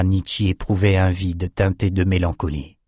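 ordinary delivery, nothing marked

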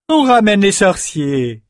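high, gloating call; triumphant. A narrator's reading. Rouva calls out her capture of the wizards, high triumphant. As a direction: crow it out in triumph. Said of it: "lança Rouva triomphante".

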